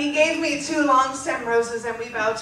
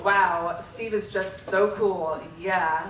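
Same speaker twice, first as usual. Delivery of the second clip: sarcastic; 6 semitones lower, 3 dB quieter, slower than usual. Pretend. Mocking her old crush.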